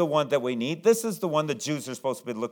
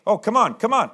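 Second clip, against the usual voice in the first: higher, impatient coaxing; urging; 6 semitones higher, 4 dB louder than usual. Acted. Jairus hurrying Jesus toward his house, urging. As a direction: hurry him along eagerly.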